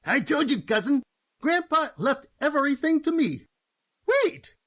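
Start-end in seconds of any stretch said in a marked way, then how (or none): 4.1-4.5 startled cry. Acted. Sudden objection at the will reading.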